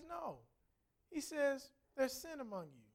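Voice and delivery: soft, matter-of-fact reply; hushed, plain verdict; understated divine answer. Retold or imitated. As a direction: answer quietly and simply, without rebuke. God's calm answer, set against rebuke.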